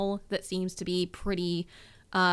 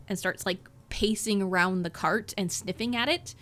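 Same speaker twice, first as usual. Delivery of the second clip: usual speaking voice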